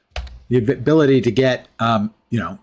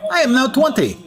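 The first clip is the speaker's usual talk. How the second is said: grand proclamation, pitched up high; proclaiming; 11 semitones higher than usual. Acted. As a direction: proclaim it like a herald. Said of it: "As you can he-, clearly hear me proclaim".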